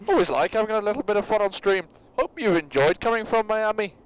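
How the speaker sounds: put-on Irish accent turned Scottish. Rereading the donation message, accidental Scottish accent. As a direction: try Irish but sound Scottish. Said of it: "That was Scottish also".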